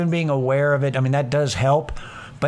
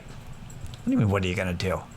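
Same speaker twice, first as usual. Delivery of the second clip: softer, breathy-free hush of disbelief; hushed exasperated; 7 semitones lower, 8 dB quieter than usual. Retold or imitated. Calm self telling panicking self to relax, hushed. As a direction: talk yourself down quietly.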